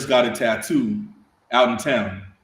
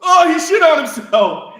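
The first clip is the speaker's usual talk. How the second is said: very high, very loud shriek of disgust; horrified sergeant; 12 semitones higher, 10 dB louder than usual. Retold or imitated. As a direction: scream it out, appalled. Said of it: "And Sergeant Santiago goes".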